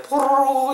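high, bubbly going-under noise; imitated sinking gurgle. Shown as a demonstration. Teacher joking that "sink" means going under, high bubbling noise. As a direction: make a high-pitched glug of someone sinking.

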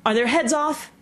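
high-pitched shouted question; shouted royal check, pitch well up. A narrator's reading. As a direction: call out the demand as an imperious queen. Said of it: "shouted the Queen".

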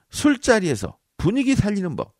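loud, high announcement of the heading; announcing a title. Shown as a demonstration. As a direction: call out the heading. Announcing first tip title. (높고 크게 외치는 제목)

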